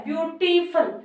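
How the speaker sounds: ब्यूटीफुल broken into ब्यू-टी-फुल; ब्यूटीफुल with every syllable stretched and distinct. Shown as a demonstration. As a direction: sound out each syllable of ब्यूटीफुल for students. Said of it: "sound ना दो से अधिक निकल रही है".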